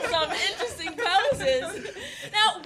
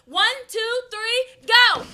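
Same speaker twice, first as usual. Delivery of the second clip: ordinary talk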